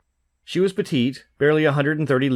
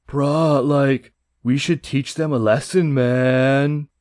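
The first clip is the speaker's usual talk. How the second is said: surfer dude; much slower than usual. Acted. Hun prince in surfer-dude voice.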